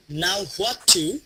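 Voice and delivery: very slow, chunked model phrase; broken into chunks. Shown as a demonstration. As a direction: say it slowly, piece by piece. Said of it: "এখন এইভাবে বলেন তো".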